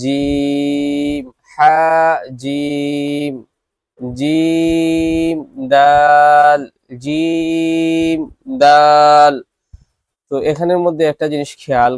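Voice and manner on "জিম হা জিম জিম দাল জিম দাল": each letter name drawn out slowly and loud; letter drill. Shown as a demonstration. Teacher models letter pairs for students to repeat, drawn out. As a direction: sing out জিম and দাল long and clear. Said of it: "আমি দুবার পড়ব এবং আপনারাও আমার সাথে সাথে উচ্চারণ".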